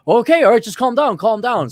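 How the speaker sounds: high-pitched, flustered appeasing; mock-placating retelling; placating salesman. Retold salesman placating angry customer.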